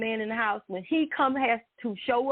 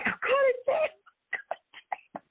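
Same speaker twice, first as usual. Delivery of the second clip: high, slow mock wail; imitating sister crying; much slower than usual. Imitating his crying sister, a high drawn-out mock wail. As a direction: stretch it out like a sobbing little girl. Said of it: "my sister, she started crying".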